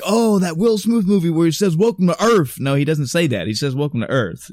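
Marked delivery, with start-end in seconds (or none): 0.0-2.6 mocking the media. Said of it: "the world and the media has spun like".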